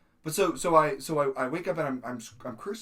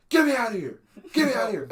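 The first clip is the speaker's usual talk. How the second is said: high, loud frantic yell; re-enacted screaming; 9 semitones higher, 8 dB louder, faster than usual. Acting out his sleep panic to tentmate, re-enacted screaming. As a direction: shout it out much higher, as if trapped.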